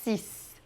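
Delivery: "six" slowly with a final s sound; slow French six. Shown as a demonstration. Teacher models six slowly to students. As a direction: draw out six, ending in s. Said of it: "O X aqui tem som de S".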